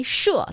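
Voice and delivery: 社 with a slow falling fourth tone. Shown as a demonstration. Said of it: "that's the fourth tone".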